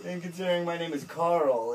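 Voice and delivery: ordinary talk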